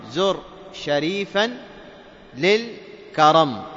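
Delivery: slow, measured, with long pauses between words; word-by-word recitation. Shown as a demonstration. Teacher listing the sun letters, slow word-by-word recitation. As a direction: recite the mnemonic one word at a time.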